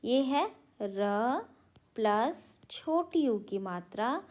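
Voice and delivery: ये है र प्लस छोटी ऊ की मात्रा spelled out slowly; slow explanation. Shown as a demonstration. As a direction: draw out each part for the children. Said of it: "ये जो र देख रहे हो ना".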